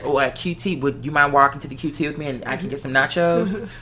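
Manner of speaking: usual speaking voice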